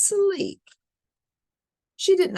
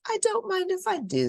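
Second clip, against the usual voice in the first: put-on higher, light little-girl voice; Goldilocks voice; faster than usual. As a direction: chirp it like a cheeky girl.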